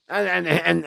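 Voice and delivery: usual speaking voice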